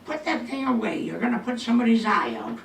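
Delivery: mock motherly telling-off; scolding mother. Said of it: "my mother would have taken one look".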